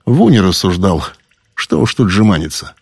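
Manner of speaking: ordinary delivery, nothing marked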